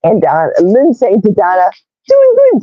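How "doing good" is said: chirpy read. Pretend. Reading viewer reply in high voice.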